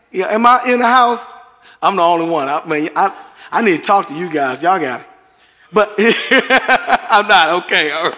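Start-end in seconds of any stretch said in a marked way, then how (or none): none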